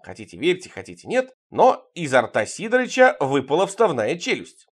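plain reading voice

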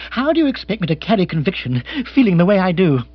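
high, fast petulant protest; agitated lord, petulant. Acted. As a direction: rush it out in exasperation.

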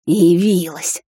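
злое шипение ведьмы; прошипеть сквозь зубы со злостью; зашипела. A narrator's reading. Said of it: "зашипела она". (мать злобно шипит на вошедшую дочь)

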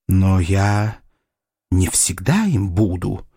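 low-pitched, steady resolve; growing firmness. A narrator's reading. Suitor answering the doctor's slight, voice growing firmer. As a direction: let the voice firm up as you object. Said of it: "голос Сэмюэля окреп".

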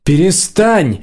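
high-pitched but contained cry; exclaimed protest. A narrator's reading. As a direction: cry out to make him stop. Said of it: "выкрикнула Нила громче".

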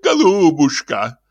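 slow, high, cooing endearment; drawn-out, melting address; tender fawning. Acted. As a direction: coo to the lady like a doting priest. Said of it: "умилялся отец Фёдор".

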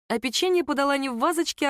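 usual speaking voice

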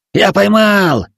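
loud, high victorious cry; triumphant shout. Acted. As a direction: yell it out in triumph. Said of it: "раздался крик".

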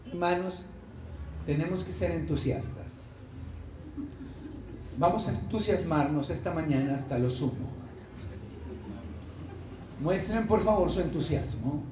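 a lifeless call to be enthusiastic; flat, unenthusiastic delivery. Shown as a demonstration. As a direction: preach enthusiasm in a dull, listless voice. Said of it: "si yo vengo a hablarle a usted de entusiasmo".

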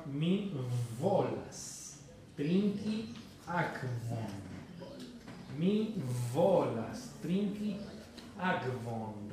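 slow, separated words with a buzzed V in volas; labiodental V demonstrated. Shown as a demonstration. Teacher translating "quiero tomar agua", slow careful model. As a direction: stretch each word, leaning on the V of volas and akvon. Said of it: "Cuando yo quiero decir".

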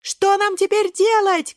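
high, slow wail; frightened cry. A narrator's reading. Frightened berries in the river, high wailing cry. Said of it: "кричат испуганные Брусничка и Клюковка".

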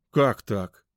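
loud, affronted protest; offended. A narrator's reading. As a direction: object with hurt surprise. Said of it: "Эдик обиженно хлопнул". (обиженно, громко)

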